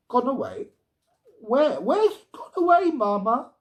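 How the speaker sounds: high, rising panicked questions; a girl suddenly afraid for her friend; alarmed child. A narrator's reading. Girl alarmed, mother says he's gone.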